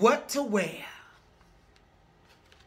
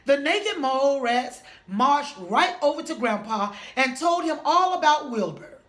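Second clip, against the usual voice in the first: plain reading voice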